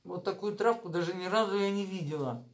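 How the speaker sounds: speaking with food in mouth; words mumbled around food; talking while chewing. Real, not acted. Seen 3: hand at mouth only before; lips move, mouth seems full.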